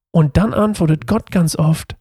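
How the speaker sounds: ordinary delivery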